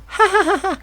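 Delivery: high bright giggle; childish giggle. A narrator's reading. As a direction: giggle like an amused kid. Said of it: "the boy chuckled".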